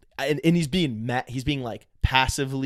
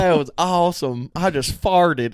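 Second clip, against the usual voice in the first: higher mock Southern goofball; put-on Southern voice; 6 semitones higher, 4 dB louder than usual. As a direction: put on the silly Southern guy voice. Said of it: "whenever you do that voice".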